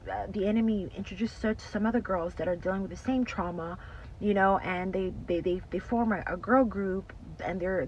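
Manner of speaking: ordinary talk, nothing marked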